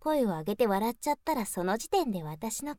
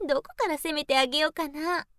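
ordinary delivery

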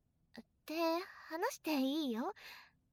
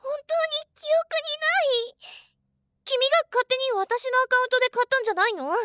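high, loud exasperated denial; indignant protest; 8 semitones higher, 10 dB louder than usual. Real, not acted. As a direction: insist you didn't buy it.

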